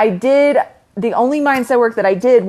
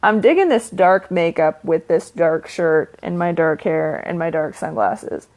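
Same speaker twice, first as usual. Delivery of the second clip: ordinary talk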